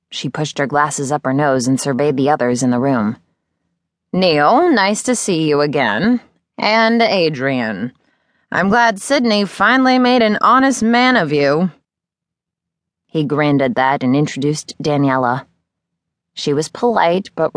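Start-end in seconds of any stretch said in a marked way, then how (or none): 4.1-11.7 higher, lightly voiced older teacher, dry and teasing; an eccentric mentor greeting old friends with wry humor; Miz Terwilliger. Older teacher greeting the newlyweds, lightly voiced and teasing.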